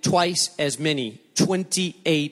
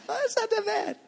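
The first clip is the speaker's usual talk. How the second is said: high, light voice of the wife, half laughing; imitating wife; 3 dB quieter than usual. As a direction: play her breezy and much higher.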